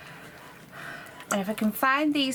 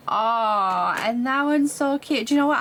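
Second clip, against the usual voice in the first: excited; 4 semitones higher, 5 dB louder than usual. Real, not acted. Delighted at cute mould result.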